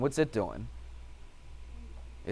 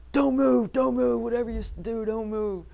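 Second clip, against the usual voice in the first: Rangers yelling; 10 semitones higher than usual. Retold or imitated. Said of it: "And they're yelling at me".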